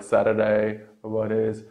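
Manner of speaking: American "Saturday, what is", T's as quick D's; American accent, flapped T's. Shown as a demonstration. Teacher contrasts the American way, flap T. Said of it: "only in American".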